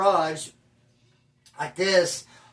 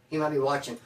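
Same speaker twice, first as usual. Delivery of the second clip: usual speaking voice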